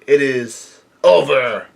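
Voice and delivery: slow, stretched final word; drawn-out sign-off. Real, not acted. Reader closes chapter nine oh two, slowly announcing it. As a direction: announce the ending with drawn-out emphasis.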